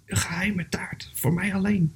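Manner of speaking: hushed, secretive musing; whispered thought. Acted. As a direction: whisper it to yourself, savouring the secret. Bear thinks secretly to itself.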